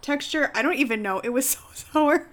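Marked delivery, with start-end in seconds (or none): 1.2-2.3 laughing. Real, not acted. Laughing at sour taste.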